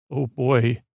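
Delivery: ordinary talk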